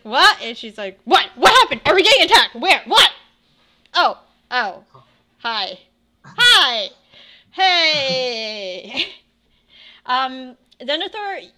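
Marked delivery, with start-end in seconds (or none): 0.0-9.1 startled panic. Acted. Woken companion panicking about an attack, then sheepish. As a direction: snap awake in alarm, then sheepish hellos. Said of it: "and it's like".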